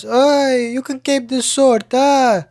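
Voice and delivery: high, hammed-up video game figure; game character voice. Man greeting the hero in a game, high put-on voice. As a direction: pitch it well up like a cartoon character. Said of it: "he meets this guy, and then he goes".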